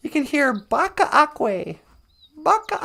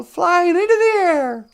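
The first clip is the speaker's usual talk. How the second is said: raised, louder, drawn-out storytelling; soaring narration; 5 semitones higher, 7 dB louder than usual. A narrator's reading. Storyteller as eagle lifts little cutie, soaring call. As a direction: stretch it out high and loud as they take flight.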